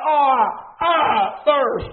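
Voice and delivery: loud preacher's version of a cry; retold cry. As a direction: cry it out from the pulpit. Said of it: "He cried out".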